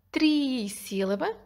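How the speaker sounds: "trissílaba" with a long, drawn-out "tri"; slow "trissílaba", opening syllable held; first syllable stressed. Shown as a demonstration. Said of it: "começa com tr".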